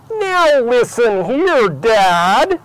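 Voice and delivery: high, loud, slow yell; acted yell. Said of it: "I yelled at the Lorax".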